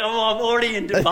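high, breezy mock voice; light teasing impression; imitating Hayden. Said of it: "He's like".

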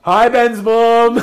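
high, loud, slow laughing hello; laughing greeting. Acted. Teasing Ben's mum with a loud, high laughing hello. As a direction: call it out mid-laugh.

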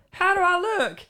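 high-pitched granny impression; grandmother. Grandmother walks in to show her haircut, high granny voice. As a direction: pipe it up like a little old lady. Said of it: "my grandmother comes in, she goes".

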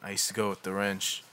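low, hushed and withdrawn, barely lifting the voice; young man mumbling to his therapist about the wrench, quiet and guarded; quiet acted line. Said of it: "Should we do it all in a Boston accent".

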